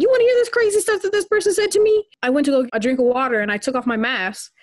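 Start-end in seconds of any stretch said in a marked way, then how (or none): 0.0-2.0 fast, high excited gossip; can't wait to tell it. Retold or imitated.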